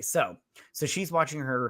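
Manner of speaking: ordinary delivery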